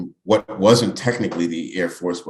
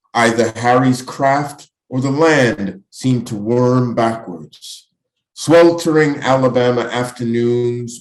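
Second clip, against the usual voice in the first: higher, projected poem reading; poetry recitation; 5 semitones higher, 5 dB louder than usual. Acted. Poet reading to an audience about flying, projected recitation. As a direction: declaim it in long, deliberate phrases, dropping on the last word.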